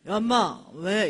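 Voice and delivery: loud, irritated retort; imitated drunk man. Drunk man roused from sleep by dad, gruffly annoyed. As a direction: play a drunk woken up, loudly. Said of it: "술이 이만큼 된 선생님".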